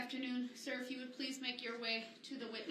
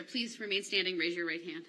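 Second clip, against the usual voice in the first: louder, projecting across the courtroom; loud, firm instruction; 12 dB louder than usual. Real, not acted. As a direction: call it out so the witness hears. Judge repeats instruction, much louder.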